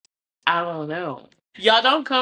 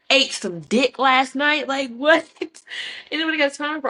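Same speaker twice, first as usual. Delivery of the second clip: exasperated exclaim; 7 semitones higher than usual. Real, not acted. Exclaiming in disbelief.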